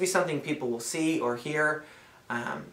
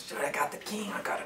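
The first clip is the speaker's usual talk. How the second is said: urgent whisper to a partner; 7 semitones higher, 5 dB quieter than usual. Acted. Player telling a teammate about the king, urgent whisper. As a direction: hiss it so the other team can't hear.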